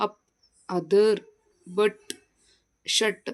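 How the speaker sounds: ಅಪ್, ಅದರ್, ಬಟ್, ಶಟ್ spaced out, each with "uh"; short "uh" demonstration. Shown as a demonstration. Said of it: "ಅನ ಇನ್ನೊಂದು different ಆ್ಯಂಗಲಲ್ಲಿ".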